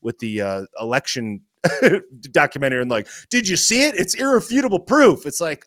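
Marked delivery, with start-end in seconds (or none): 3.3-5.3 high, louder mock-excited fan voice; mocking believer. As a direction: ham up a gullible true believer. Mocking election-fraud believers.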